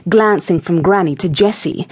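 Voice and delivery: ordinary delivery, nothing marked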